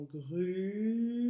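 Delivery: grue with the U held very long; "grue" drawn out to make the U heard; stress on U. Shown as a demonstration. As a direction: stretch out the vowel of "grue". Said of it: "On entend le U de tortue".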